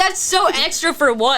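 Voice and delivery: high, slow incredulous complaint; retold indignation. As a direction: draw it out in mock outrage. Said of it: "That shit made me mad".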